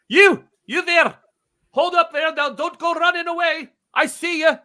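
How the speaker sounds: high, loud calling out across the cemetery; gruff man shouting. Acted. Man with gun shouts at intruders, loud and high. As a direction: yell at them to stop running. GM voices man catching them.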